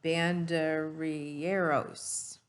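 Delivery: "Banderilleros" said slowly, syllable by syllable; careful pronunciation. Shown as a demonstration. Teacher models the vocabulary word for children, slow careful "Banderilleros". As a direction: sound out "Banderilleros" slowly and clearly. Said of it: "let's try this word again".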